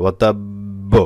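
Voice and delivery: ওয়াতাব্ব drawn out on b, then released; strong qalqalah. Shown as a demonstration. Teacher shows how to pause on it, strong qalqalah. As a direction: close on the b of ওয়াতাব্ব, hold, bounce it. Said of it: "এভাবে".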